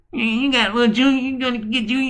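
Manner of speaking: imitating a meek little voice; soft little voice. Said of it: "voice that, kids used to get beat up in school for having".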